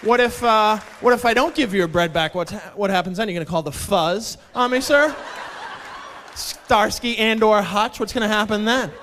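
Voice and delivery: loud, goading mock challenge; sarcastic taunting. Barista refusing to return customer's bread, loudly taunting.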